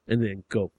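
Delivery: ordinary delivery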